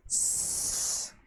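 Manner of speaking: a long unvoiced s hiss. Shown as a demonstration. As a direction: hiss a clean s sound. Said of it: "você vai pronunciar o som". (um s sibilado, sem voz)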